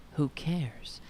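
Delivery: whispered, dismissive aside. Real, not acted. Reader muttering her scorn for the article, whispered. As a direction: toss off a whispered who cares.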